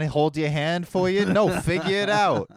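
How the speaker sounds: put-on loud, brash New York accent. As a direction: push it like a pushy New Yorker, New York accent. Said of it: "that's a pretty New York accent you got going".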